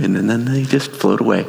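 ordinary talk, nothing marked

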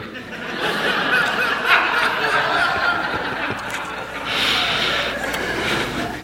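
high unvoiced laugh that runs on; long airy laugh. Real, not acted. Pastor laughing at the husband's surprise attack, long airy laugh. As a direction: laugh it off in disbelief.

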